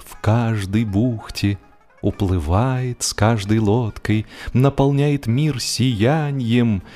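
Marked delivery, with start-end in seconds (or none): none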